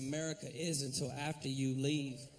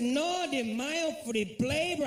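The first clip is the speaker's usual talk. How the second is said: higher, slow mock Spanish accent; a cashier struggling with the order in a Spanish accent; hammed-up Spanish-accented cashier; 7 semitones higher, slower than usual. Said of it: "He said".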